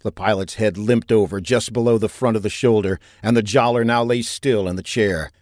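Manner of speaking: ordinary delivery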